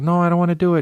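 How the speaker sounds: high-pitched refusal, near tears; whiny scared kid. Retold or imitated. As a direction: protest like a frightened child.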